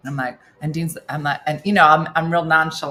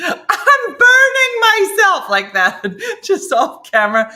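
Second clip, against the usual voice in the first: high laughing squeal; 13 semitones higher, 10 dB louder, slower than usual. Real, not acted. Host laughing about burning herself off camera, giggling. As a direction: laugh through the words, pitched up.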